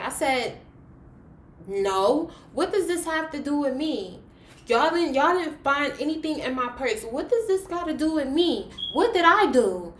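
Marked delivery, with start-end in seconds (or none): 1.6-9.9 high, loud pleading protest; upset, raised-voice objection in a retelling; indignant protest. Retold or imitated. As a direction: protest your innocence, pitch rising high. Retold tearful protest to the accuser.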